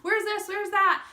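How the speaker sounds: high, loud and eager; eager demanding customer. Retold or imitated. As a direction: pester for the products excitedly. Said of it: "my customer's like".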